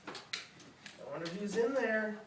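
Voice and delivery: ordinary delivery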